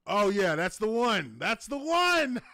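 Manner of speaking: loud, rising excited recognition; thrilled at spotting it. Real, not acted. Delighted at finding the picture.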